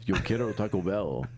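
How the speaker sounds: mock Spanish ad catchphrase; Taco Bell chihuahua. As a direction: quote the chihuahua ad in a lightly put-on voice.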